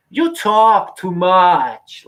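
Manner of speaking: loud, high, drawn-out mock Japanese accent; imitated Japanese man. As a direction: stretch each word in a put-on Japanese accent, imitating son's impression. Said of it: "he turns into a Japanese man".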